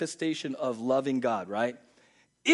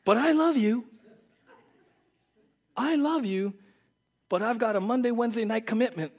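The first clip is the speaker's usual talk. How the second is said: higher, louder pleading husband voice; mock pleading husband; 9 semitones higher, 6 dB louder than usual. Husband pleading with his wife, mock pleading. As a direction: beg like a husband caught out. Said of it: "would this fly in a marriage".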